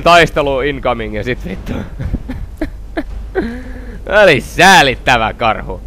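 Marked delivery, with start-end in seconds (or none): 1.8-4.2 quiet, high, wheezy laughter; breathy laugh. Real, not acted. Player amused by the bear fight, wheezy laugh. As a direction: laugh mostly on air.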